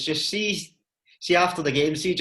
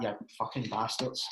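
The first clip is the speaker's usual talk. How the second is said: soft, low, defeated mutter; a quiet, resigned little curse; mock grumble; 5 semitones lower, 11 dB quieter than usual. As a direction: mutter it under your breath, beaten. Retold reaction to losing the bet.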